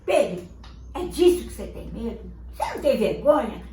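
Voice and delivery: loud scolding father; stern father. Acted. Father scolds Pedro, loudly and sternly. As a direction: shame the boy for his fear.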